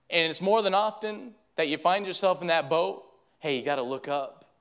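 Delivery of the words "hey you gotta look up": softer, lower, intimate plea; hushed appeal. Real, not acted. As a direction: draw them in, quietly. Preacher drops voice for appeal.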